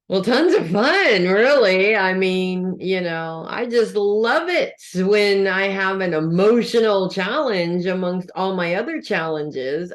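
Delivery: mock enthusiasm with swooping emphasis; sarcastic. Real, not acted. Astrologer joking to viewers about an emotional challenge, sarcastic. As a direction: gush sarcastically about loving it. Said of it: "I'm being totally sarcastic".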